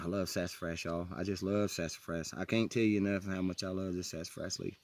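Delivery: low, soft, loving murmur; soft and low, savoring it; tender affection. Real, not acted. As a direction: confide your love quietly. Savoring a beloved plant.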